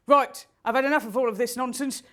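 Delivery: ordinary talk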